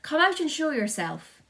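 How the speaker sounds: loud, high commanding call; shout. A narrator's reading. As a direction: order them out at the top of your voice. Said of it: "shouted the chief of police".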